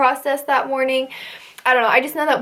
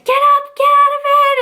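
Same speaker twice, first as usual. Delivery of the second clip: much higher, loud screamed order; screaming drill instructor; 5 dB louder than usual. Retold or imitated. Female instructor screaming at recruits to get up. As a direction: yell them out of bed. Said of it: "screaming at us".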